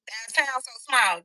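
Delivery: ordinary talk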